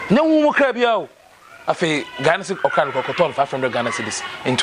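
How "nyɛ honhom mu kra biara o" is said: high-pitched, emphatic insistence; emphatic protest. Acted. Insisting it is no spirit cat, emphatic protest. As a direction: push the denial up high, insistently.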